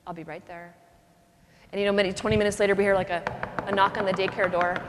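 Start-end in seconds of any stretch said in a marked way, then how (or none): 0.1-0.7 hushed, lower-key reply, mildly imitated; changed, concerned voice. Mom hears her baby is sick, voice drops soft. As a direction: answer softly and at once, as a concerned mother. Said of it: "immediately her voice changes".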